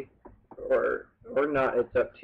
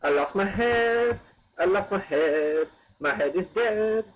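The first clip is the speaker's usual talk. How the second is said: tag; 7 semitones higher, 5 dB louder, slower than usual. Pretend. Wailing over game character's death.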